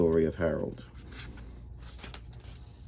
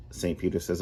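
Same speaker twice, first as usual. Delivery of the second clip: normal talking voice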